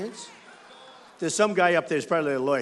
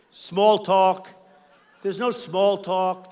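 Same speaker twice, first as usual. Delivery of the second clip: ordinary talk, nothing marked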